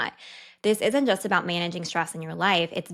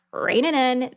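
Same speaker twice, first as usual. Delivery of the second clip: telling herself off; 6 semitones higher, 5 dB louder than usual. Retold or imitated. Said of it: "I'm like".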